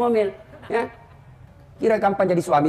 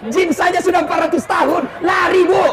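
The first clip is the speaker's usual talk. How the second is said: emphatic punchline; 8 semitones higher than usual. Real, not acted. Punchline shouted to audience.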